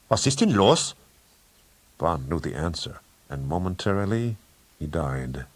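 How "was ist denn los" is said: sharp, loud and higher German question; sharp demand. Acted. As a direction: snap it out at the intruders. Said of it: "The Count said sharply".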